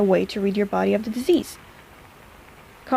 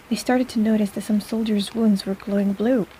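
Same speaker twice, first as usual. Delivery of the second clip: ordinary talk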